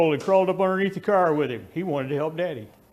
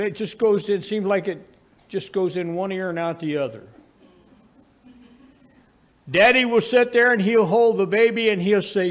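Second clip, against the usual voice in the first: usual speaking voice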